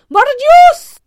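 very loud, high-pitched swearing cry; exclaimed oath. A narrator's reading. Duellist whose blow was parried, shouted oath. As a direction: burst out with a full-voiced curse. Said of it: "s'écria-t-il avec son accent gascon".

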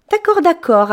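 sweet and chatty, eager to help; fairy thanking the boy, bubbly; cheerful fairy. Acted. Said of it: "a dit la fée".

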